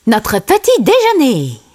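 high-pitched character reply; Timon. A narrator's reading. Timon answers Pumba about breakfast, high character voice. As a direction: pitch it up for a cartoon sidekick. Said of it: "répondit Timon".

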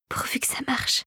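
very soft, airy whisper; breathed whisper. A narrator's reading. Clara hopes her rewiring works, whispering. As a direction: breathe it out, barely voiced. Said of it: "souffla-t-elle".

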